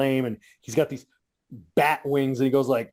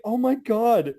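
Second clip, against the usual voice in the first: higher, cooing mock pity; mocking baby talk; 6 semitones higher than usual. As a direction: sneer like comforting a baby. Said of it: "dying because I'm like".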